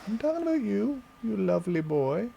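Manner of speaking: high pet coo. Real, not acted. Owner squealing praise at his cat, high coo.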